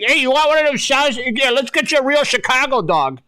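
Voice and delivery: high-pitched mock Boston vendor; pushy caricature with a Boston accent. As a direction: hawk it in a hammed-up Boston accent. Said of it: "I'm giving them a Boston accent".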